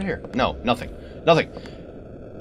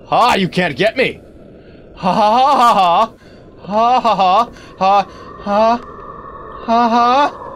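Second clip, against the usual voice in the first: mock taunting laugh; 5 semitones higher than usual. Taunting the monster after escaping.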